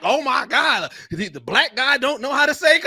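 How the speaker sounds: high-pitched mock dismay; outraged commenters. Host voicing comments on a mispronunciation, mock outrage. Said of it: "those comments was like".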